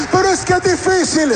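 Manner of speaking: high mock complaint; hammed-up congregant's objection; objecting congregant. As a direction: protest in a put-on high voice.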